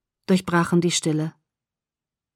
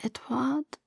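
faint, high, drowsy call; struggling to articulate; 6 semitones higher, 10 dB quieter than usual. A narrator's reading. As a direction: barely get the name out, fading. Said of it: "Mühe, seinen Namen deutlich auszusprechen".

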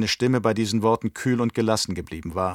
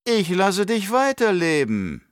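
put-on higher, slow, coolly menacing old Baron; unmoved Baron; 10 semitones higher, 5 dB louder than usual. Baron coolly threatening his nephew, slow and raised. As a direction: grant his life with icy indifference. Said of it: "erwiderte der Baron ungerührt".

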